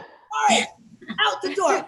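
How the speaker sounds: mock military commands; army commander. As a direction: take charge like a soldier on a mission. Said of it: "I'm like".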